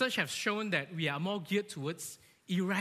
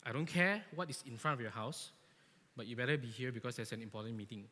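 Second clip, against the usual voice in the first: lower, softer, firm boss voice; stern boss; 7 semitones lower, 5 dB quieter than usual. Retold or imitated. Boss on the phone to a scared employee, low stern. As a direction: lay down the law, low and cold. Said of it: "Then your boss say".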